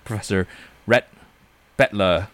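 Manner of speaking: put-on British accent on a Southern name. Podcaster naming the comic's professor, mock British accent. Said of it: "I did a British accent".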